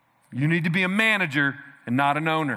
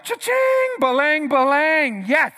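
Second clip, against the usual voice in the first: squealed cha-ching, then slow sing-song balang, balang; giddy cash-register glee; 9 semitones higher, 5 dB louder, much slower than usual. Pretend. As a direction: act like you just got a windfall. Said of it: "You'd be like".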